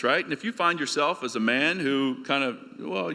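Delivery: ordinary talk, nothing marked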